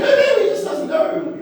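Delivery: high, frantic, laughing intervention; mock panicked pleading. Friends saving colleague from the big man, high mock panic. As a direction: plead high as if stopping a fight. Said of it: "And we all said".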